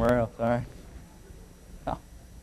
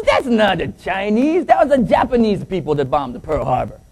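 mock Chinese accent; 6 semitones higher than usual. Co-pilot correcting the pilot, mock Chinese accent. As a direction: protest in a hammed-up Chinese accent. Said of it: "The Chinese man says".

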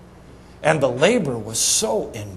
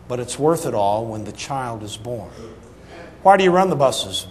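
ordinary talk, nothing marked

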